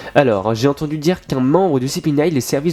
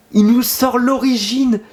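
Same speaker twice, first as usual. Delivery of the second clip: high, slow and amazed; excited; 9 semitones higher, slower than usual. Real, not acted. Telling viewers about the origin, excited. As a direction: still reeling from the twist. Still stunned.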